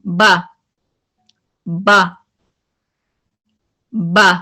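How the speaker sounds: firm बा with the breath stopped; Arabic letter बा demonstrated; strong बा. Shown as a demonstration. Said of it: "अब आपकी आवाज हाई हो रही है".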